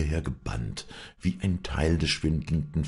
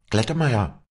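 high, louder call; raised voice into someone's ear; calling into his ear; 9 semitones higher, 6 dB louder than usual. A narrator's reading. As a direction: call the farmhand by name, urgently. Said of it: "rief sie dem Knecht ins Ohr".

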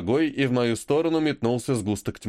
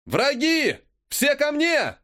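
yelling; 13 semitones higher, slower than usual. Acted. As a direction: yell to rally the troops. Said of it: "я заорал".

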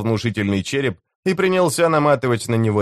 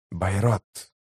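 hushed, softened call of a name; softly murmured summons; understated whisper; 2 semitones lower, 5 dB quieter than usual. A narrator's reading. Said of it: "прошептал предводитель".

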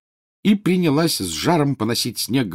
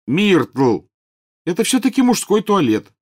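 loud, high indignant shout; indignant call; 11 semitones higher, 6 dB louder, slower than usual. A narrator's reading. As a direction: call her out, outraged. Boys call out at ghost.

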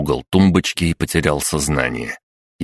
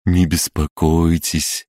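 slow, soothing doctor voice; lulling voice; slower than usual. Acted. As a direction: lull him to calm. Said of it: "как граммофон с заезженной пластинкой".

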